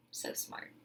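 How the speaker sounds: sarcastic whisper to herself; dry self-mocking whisper; whispered aside. Real, not acted. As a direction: mutter it under your breath, mocking yourself. Sarcastic aside after forgetting stove.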